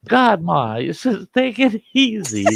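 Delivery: high-pitched whine at Ma; mock aggrieved son, voice climbing; imitated protest. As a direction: protest like a smacked grown son. Slapped son protests to mother.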